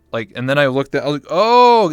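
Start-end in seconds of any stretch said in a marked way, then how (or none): 1.3-1.9 sudden loud high oh of realization; jolted by embarrassment; mock startled gasp of oh.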